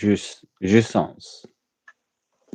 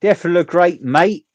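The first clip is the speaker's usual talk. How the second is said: loud, high chimney-sweep Cockney; hammed-up London accent; British accent; 8 semitones higher, 8 dB louder than usual. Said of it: "Right, Angela?".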